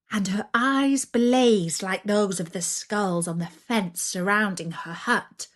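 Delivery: usual speaking voice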